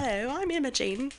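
put-on sing-song British English accent; a bad British English accent. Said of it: "was a terrible English accent".

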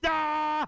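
sudden high yell into nothing; screaming into the abyss. Retold or imitated. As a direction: let out a wild, high scream. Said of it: "some guy screaming into the abyss".

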